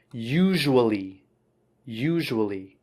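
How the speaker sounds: usually drawn out as YOO-zhoo-uh-lee; model pronunciation. Shown as a demonstration. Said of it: "couple of pronunciation things".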